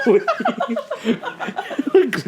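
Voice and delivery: "un-Australian" stretched out very slow and high, laughing; exaggerated mock outrage at "un-Australian"; mock drawn-out un-Australian. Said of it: "that's your Canadian side coming through".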